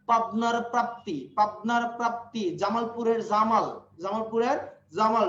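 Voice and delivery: slow, leaning on the matching first sounds of পাবনার প্রাপ্তি; slow alliteration demo. Shown as a demonstration. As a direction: stress the shared opening sounds. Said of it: "initial sound গুলো". (অনুপ্রাস জোর দিয়ে ধীরে)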